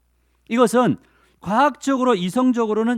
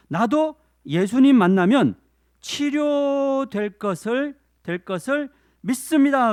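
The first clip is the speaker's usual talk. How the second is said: believing woman; 8 semitones higher, slower than usual. Acted. Woman voiced pleading faith.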